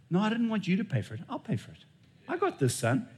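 mild God as tender father. Acted.

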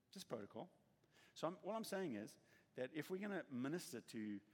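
ordinary delivery

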